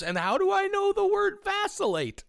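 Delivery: very high, squeaky put-on voice ending in a laugh; mock baffled thief. Cohost adds the tech bro's confused line, squeaky high voice. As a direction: squeak it in comic bewilderment. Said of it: "you should have thrown in".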